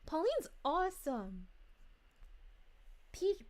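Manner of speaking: ordinary talk, nothing marked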